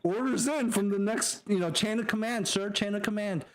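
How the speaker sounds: high-pitched obedient soldier voice; comic flustered subordinate; eager sergeant. Acted. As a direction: relay orders with voice way up high. Acts sergeant relaying orders eagerly.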